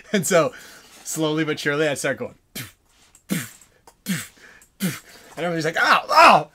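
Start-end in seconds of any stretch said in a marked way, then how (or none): 2.5-5.0 short airy pops with pauses between; mouth-made gun puffs; imitated paintball shots. 5.8-6.5 high, loud mock cries of being hit; yelp, then holler in protest; hammed-up shouts from a shot player; imitated pained yelps.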